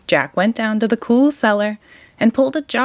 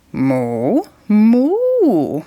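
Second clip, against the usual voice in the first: cow mooing; 3 dB louder than usual. A narrator's reading. Cow being milked by Jack, mooing. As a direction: moo like a cow. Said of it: "said the spotted cow".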